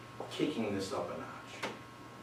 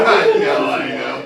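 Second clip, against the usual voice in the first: laughing; 5 semitones higher, 20 dB louder, slower than usual. Real, not acted. Laughs along at teasing.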